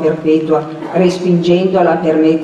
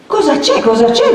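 high, breathless urgency; alarmed and winded; panting question; 10 semitones higher, 4 dB louder than usual. A narrator's reading. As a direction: gasp out the question after a run. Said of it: "domandò Perpetua ansante".

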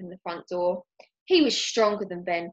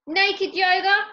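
incredulous; 11 semitones higher, 7 dB louder, slower than usual. Acted. Neighbour scoffs.

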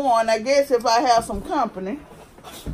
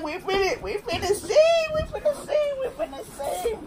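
rising higher each time, laughing through it; giggly singsong; 10 semitones higher, 6 dB quieter than usual. Real, not acted. Vlogger teasing viewers before opening the box, giggly singsong. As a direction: tease it in a giggly, climbing singsong.

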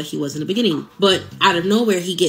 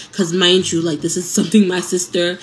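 ordinary delivery

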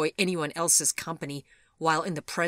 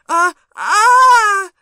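loud, high drawn-out scream; piercing scream of horror; horrified scream; 20 dB louder than usual. A narrator's reading. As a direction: stammer into a full shriek. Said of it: "she screamed".

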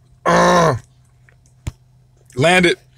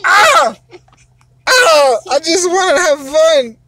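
mock wail; 8 semitones higher, 4 dB louder than usual. Dad hams up losing a game.

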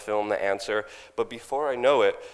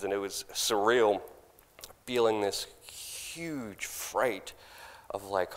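ordinary talk

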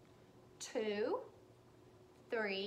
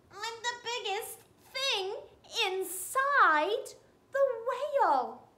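higher, half-sobbing wail from inside the whale; crying squid; 6 semitones higher, 6 dB louder than usual. Acted. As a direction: wail it like a sorry little squid.

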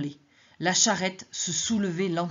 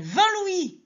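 high insistent call; 13 semitones higher, 5 dB louder than usual. Acted. Madeleine raises offer again.

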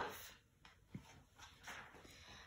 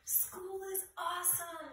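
put-on high, bright kid's shout; excited shout; 9 semitones higher, 5 dB quieter than usual. A narrator's reading. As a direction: call it out high. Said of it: "shouted Clark the shark".

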